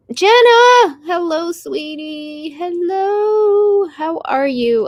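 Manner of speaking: excited greeting. Real, not acted. Streamer greeting Jenna as she joins, high excited sing-song. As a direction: call out to her like a delighted friend. Greeting a friend arriving in chat.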